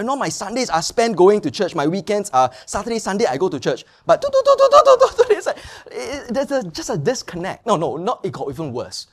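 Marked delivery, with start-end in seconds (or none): 4.0-5.3 high, loud do-do-do bleep; high censor-beep noise; mock beep. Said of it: "bleeping out words".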